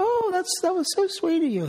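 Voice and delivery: high, gushing falsetto wife; high sweet thanks as the touched wife; wife's voice. Retold or imitated.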